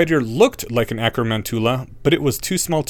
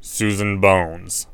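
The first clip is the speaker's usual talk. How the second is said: cold teacher; 3 semitones lower, much slower than usual. A narrator's reading. Snape taking roll.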